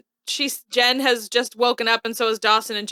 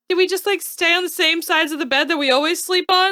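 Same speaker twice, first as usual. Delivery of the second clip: imitating Dawson; 6 semitones higher than usual. Acting out Dawson in bed.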